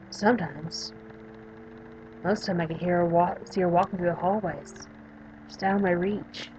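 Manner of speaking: subdued, lightly acted patient character; a hint of a withdrawn young patient; lightly voiced young patient. Patient describing the girl she sees, subdued character voice.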